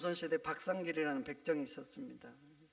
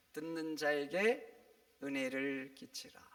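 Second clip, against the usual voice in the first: 듣는 자에게 은혜를 끼치라 slowly, each word weighed; slow emphasis; 3 semitones lower, slower than usual. Acted. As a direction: drive the verse home slowly. Repeats verse slowly for emphasis. (천천히 힘주어 되새기듯)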